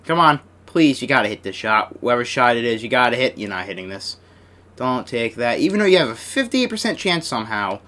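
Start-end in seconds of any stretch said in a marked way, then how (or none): none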